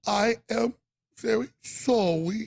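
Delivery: slow, drawn-out mock apology; mock sorry, stretched slowly; fake apology. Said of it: "He was".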